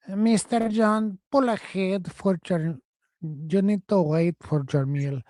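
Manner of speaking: put-on slow, accented drive-thru worker; mock worker with accent. Imitating the worker ordering him to pull ahead, accented. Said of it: "They're just like".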